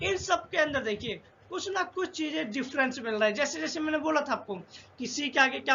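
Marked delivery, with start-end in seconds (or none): none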